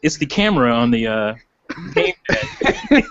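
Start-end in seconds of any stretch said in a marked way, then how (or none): 1.9-3.1 laughing. Real, not acted. Laughs out the word.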